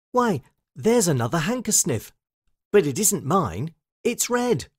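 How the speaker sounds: put-on high little girl, surprised; Lucy. Lucy spots another handkerchief.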